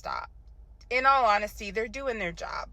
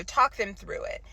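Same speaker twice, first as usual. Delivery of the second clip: usual speaking voice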